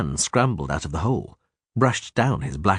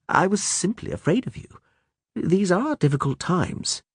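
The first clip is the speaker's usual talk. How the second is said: calm, measured gentleman; 10 semitones higher than usual. A narrator's reading. Regis voiced.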